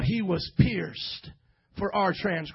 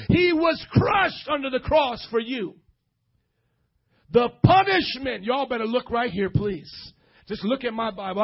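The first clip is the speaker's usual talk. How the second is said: ordinary talk, nothing marked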